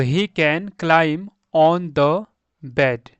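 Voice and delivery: word by word, slowly and loudly, pauses between; slow model reading. Shown as a demonstration. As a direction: read it out slowly for beginners. (धीरे-धीरे, शब्द-दर-शब्द पढ़ना)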